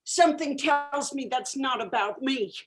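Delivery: ordinary delivery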